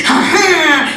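put-on a proud old painter's crowing laugh; triumphant laugh. A narrator's reading. Old painter crows at the visitors before his canvas, laughing triumphantly. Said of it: "gridò".

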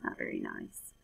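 soft unvoiced aside to listeners; whispered aside. A narrator's reading. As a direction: whisper the judgement to the kids. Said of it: "narrator's whispered aside".

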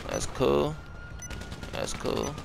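usual speaking voice

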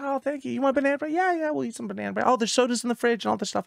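sweet fussing mother; mom voice. Retold or imitated. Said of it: "And I'm like".

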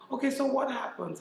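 exasperated. Retold or imitated. Staff member leaving mid-event.

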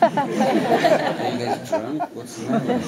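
a high hammed-up voice of an outsider who can't believe everyone is laughing; puzzled onlookers. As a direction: squeak it out through laughter like a bewildered stranger. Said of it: "People were like".

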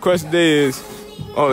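ordinary talk

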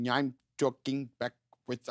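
slow reversed-sounding delivery; backwards speech. Shown as a demonstration. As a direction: attempt the Red Room backwards talk. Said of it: "I can do the talking backwards thing".

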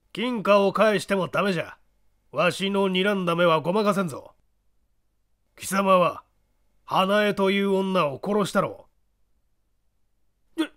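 put-on sly menacing old man; creaky wise old man. Small man accuses.

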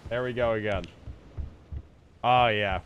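normal talking voice

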